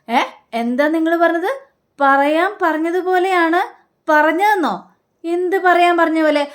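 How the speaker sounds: high, sharp question; what did you just say; suspicious surprise. Retold or imitated. Sachi and Revathi catch the uncle's slip, surprised and suspicious.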